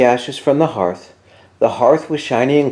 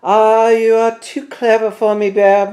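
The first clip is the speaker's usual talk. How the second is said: higher sly little Mouse voice; Mouse admitting; 10 semitones higher, 4 dB louder than usual. A narrator's reading. Mouse owning up to Bear, in a high playful voice. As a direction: concede with a high, pleased squeak of a voice. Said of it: "Mouse admitted".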